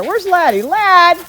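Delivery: high, loud call for the dog; high call searching for the dog. Real, not acted. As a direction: call out across the yard for him. Calling dog Laddie to come.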